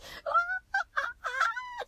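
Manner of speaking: high squeal of delight; squealing with joy. Real, not acted. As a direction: squeal it out, thrilled. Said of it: "うわーって感じ".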